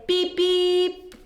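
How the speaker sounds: high honking beep; truck horn beep. Acted. Teacher voicing Blue's horn for the children, playful beep. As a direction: toot it like a little truck horn. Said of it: "Little Blue likes to say".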